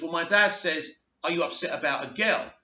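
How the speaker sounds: normal talking voice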